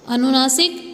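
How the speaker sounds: normal talking voice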